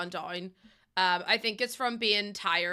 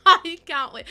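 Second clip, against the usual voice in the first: much louder and higher, bursting with glee; excited burst; 6 semitones higher, 12 dB louder, slower than usual. Real, not acted. Streamer to chat about the pizzeria build, loud excited squeal. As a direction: shout it out, can't contain the excitement.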